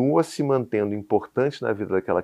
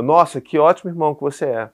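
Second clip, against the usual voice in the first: loud, higher mock admiration; sarcastic praise; 4 semitones higher, 7 dB louder than usual. Dad to the son who took cutlery only for himself, sarcastic. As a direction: praise him while meaning the opposite. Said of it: "eu fui sarcástico com ele".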